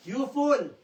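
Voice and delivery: God's rebuke. Acted. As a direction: rebuke him hard as God would.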